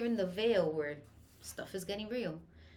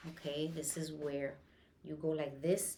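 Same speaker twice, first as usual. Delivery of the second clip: usual speaking voice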